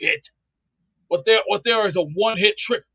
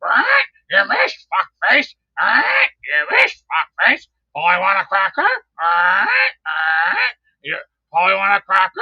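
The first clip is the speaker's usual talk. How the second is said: Johnny Dangerously parrot. Retold or imitated. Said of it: "sound like the parrot from, uh, Johnny Dangerously when he goes".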